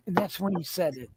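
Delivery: ordinary delivery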